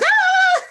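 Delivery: loud, very high "Ah!"; mock hyper shriek of excess energy; high excited squeal. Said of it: "my voice is even lower. Before, I was like".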